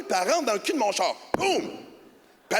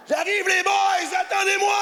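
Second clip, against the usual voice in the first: high, slow, hammed-up call to the boys; mock shout; 12 semitones higher, 4 dB louder, slower than usual. Joking that he came from France, shouting to the boys. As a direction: stretch it out like a cartoon Frenchman.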